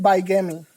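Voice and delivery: bigamy said as bye-GAM-ee, with a long i; common mispronunciation. Shown as a demonstration. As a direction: say bigamy wrongly, starting like bye. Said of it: "They pronounce it".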